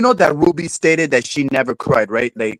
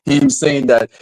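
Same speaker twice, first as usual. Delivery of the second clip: usual speaking voice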